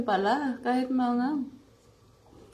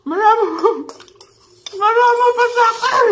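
high, loud laughing cry; excited laughing shout; 12 semitones higher, 9 dB louder, faster than usual. Real, not acted. Shouting laughing at her portion.

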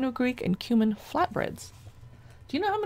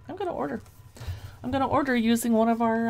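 usual speaking voice

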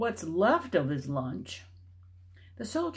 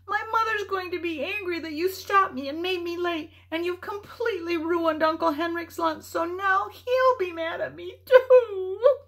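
higher, tearful, louder at the end; crying; 12 semitones higher, 6 dB louder than usual. A narrator's reading. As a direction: wail through tears. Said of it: "she began to cry".

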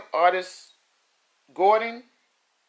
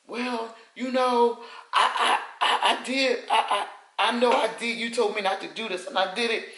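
a lightly voiced sheepish friend, higher; mock stammering confession; 4 semitones higher than usual. Friend admitting she ignored the advice, sheepish. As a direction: stumble over the I, I, I. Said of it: "when people say".

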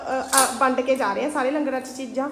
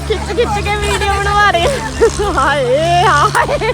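dramatic loud aunty; 5 semitones higher, 7 dB louder, much slower than usual. Real, not acted. Loud excited aunty filming everyone.

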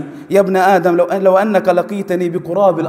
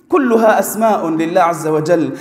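ordinary talk

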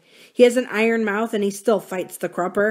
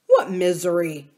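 demanding. A narrator's reading. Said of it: "Samuel demanded".

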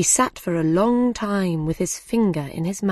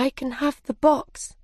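sadly; 7 semitones higher than usual. Acted. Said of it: "said Alice sadly".

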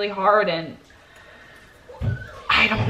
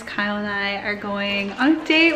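normal talking voice

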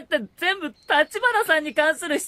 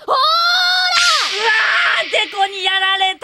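much higher, loud hammed-up wail; mock agonised scream; 8 semitones higher, 7 dB louder, much slower than usual. Meguru pretending to be blinded by Miyako's forehead, screaming. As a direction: cry out as if struck by the glare.

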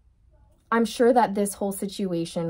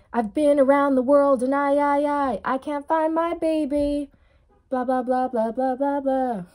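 mock lament; 5 semitones higher than usual. Voicing runner searching world.

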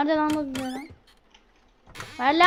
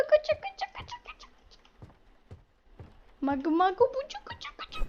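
put-on squeaky baby babble; 12 semitones higher, 3 dB quieter than usual. Squeaky baby-voiced kaça.

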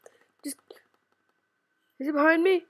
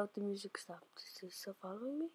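fast frightened whisper; 5 semitones lower, 21 dB quieter than usual. Real, not acted. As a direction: breathe it, scared and quick. Hiding from a pursuer.

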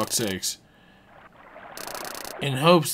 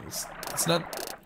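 ordinary delivery

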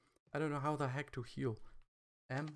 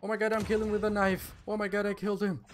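loud, high excited yell; real gamer excitement, raised voice; thrilled disbelief; 5 semitones higher, 8 dB louder, faster than usual. Real, not acted. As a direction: shout it, amazed at your own lucky kill. Player gets surprise knife kill.

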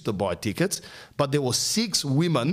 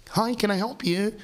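much higher bright customer-service hello; preppy perky greeting; 10 semitones higher than usual. Retold or imitated. Perky desk worker greeting the customer, preppy and much higher. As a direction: chirp it like a perky shop assistant. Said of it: "Much more preppier".

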